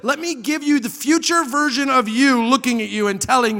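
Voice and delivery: high, loud, driving sermon delivery; impassioned preaching. Real, not acted. Preaches at full force.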